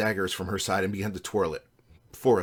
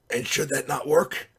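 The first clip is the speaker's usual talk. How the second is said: slow, higher croaky voice; croaking; 6 semitones higher, slower than usual. A narrator's reading. Sanjay doubts the plan to his friends, croaking. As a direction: croak a sceptical question. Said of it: "Sanjay croaked".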